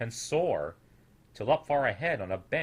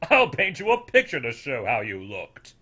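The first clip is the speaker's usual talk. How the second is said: louder, sneering elder voice; scornful old snoodle; 7 dB louder than usual. A narrator's reading. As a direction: rub his failure in.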